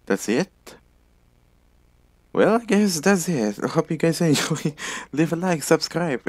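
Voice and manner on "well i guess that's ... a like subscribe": excited outro. Real, not acted. Cheery outro to viewers.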